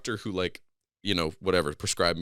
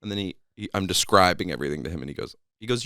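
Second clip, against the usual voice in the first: usual speaking voice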